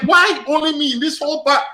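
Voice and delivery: high-pitched indignant protest; retold losing it. Customer singled out by bank security guard, indignant retold protest. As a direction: re-enact losing your temper, voice shooting up. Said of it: "I actually started losing it".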